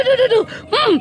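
ordinary talk, nothing marked

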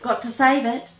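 cried. Acted. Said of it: "cried Ring-tailed Monster".

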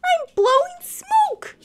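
high, loud thrilled dragon voice; a small dragon amazed at himself; excited little dragon. Acted.

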